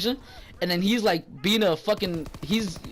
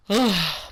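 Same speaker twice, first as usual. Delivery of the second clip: sigh. Real, not acted. Sighs after the rant.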